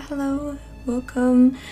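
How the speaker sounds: ordinary delivery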